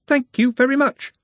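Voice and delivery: a cheery skeleton with a hoarse high voice. A narrator's reading. Skeleton Charlie speaks.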